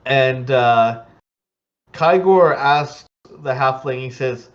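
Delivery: ordinary talk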